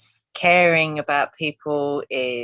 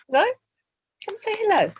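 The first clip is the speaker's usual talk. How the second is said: high, sing-song coaxing; high coaxing call to a cat; coaxing pet voice; 14 semitones higher, much faster than usual. Real, not acted.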